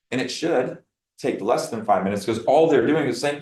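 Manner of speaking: usual speaking voice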